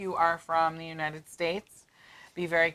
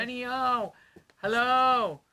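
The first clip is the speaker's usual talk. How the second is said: calling out; 8 semitones higher, 3 dB louder, slower than usual. Real, not acted. Greeting a viewer, calling out.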